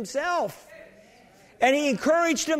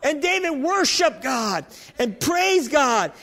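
ordinary delivery

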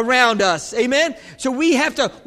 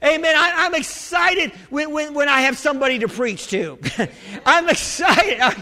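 excited preaching; 4 semitones higher than usual. Real, not acted. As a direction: preach it with rising joy.

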